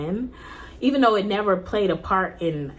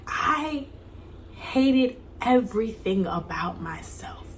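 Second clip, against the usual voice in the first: hushed confession; 4 semitones higher, slower than usual. Real, not acted. Confessing self-hatred.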